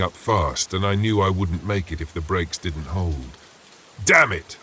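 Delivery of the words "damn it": loud angry shout. Acted. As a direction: yell it out in frustration. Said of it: "I shouted".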